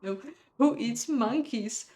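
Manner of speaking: usual speaking voice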